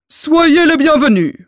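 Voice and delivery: booming giant welcome; loud voice. A narrator's reading. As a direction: welcome them in a big loud voice. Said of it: "d'une voix forte".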